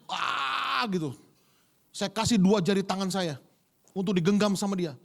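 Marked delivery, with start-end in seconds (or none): 0.1-0.9 high, drawn-out mock push; imitated straining groan. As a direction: groan as if bearing down in labor. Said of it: "Itu saya ngeden". (erangan mengejan tiruan)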